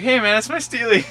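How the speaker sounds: high, loud mock objection; protesting kid. Kid defending his prized marble, high imitated protest.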